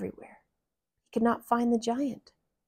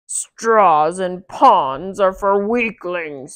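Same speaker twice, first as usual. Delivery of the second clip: loud, very slow sneering giant; 9 dB louder, much slower than usual. Acted. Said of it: "said the giant".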